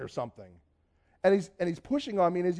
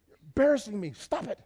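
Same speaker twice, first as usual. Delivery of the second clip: embarrassed scolding; 5 semitones higher than usual. Retold or imitated. Embarrassed scolding of friend, retold.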